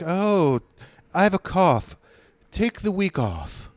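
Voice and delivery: airy, pampered mock voice; mocking coddled New Yorker. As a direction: mimic a coddled city guy. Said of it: "went back and forth with Wisconsin accents".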